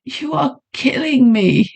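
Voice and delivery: breathless high gasp. A narrator's reading. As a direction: gasp it out in pain. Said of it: "she gasped".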